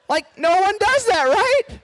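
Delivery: very high, loud squeal of disbelief; comic incredulity. Real, not acted. Preacher to room after the Satan comparison, high-pitched incredulity.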